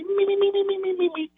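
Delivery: high squeaky Beaker meeping; Beaker squeak. Retold or imitated. Mocking a mad coach as squeaky Beaker. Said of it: "he sounds like Beaker".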